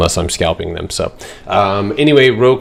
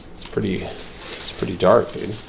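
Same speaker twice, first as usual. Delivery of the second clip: usual speaking voice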